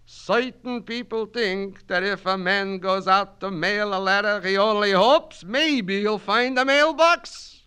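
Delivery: put-on high, loud Yiddish-accented orator; Yiddish-accented Kaplan, holding forth grandly; Yiddish-accented immigrant student. Student lecturing his night class in a Yiddish accent.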